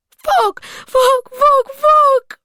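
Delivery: high, loud, repeated screams; screamed. A narrator's reading. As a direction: scream each word harder. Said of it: "Maggie screamed".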